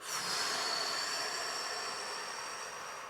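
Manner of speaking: long unvoiced exhale; slow deep breath. Shown as a demonstration. Showing viewers how to breathe slowly, long exhale. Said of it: "just to, like".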